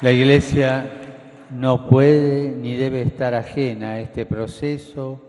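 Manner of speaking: loud, emphatic preaching to a crowd; booming balcony speech. Real, not acted. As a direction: proclaim it to the square.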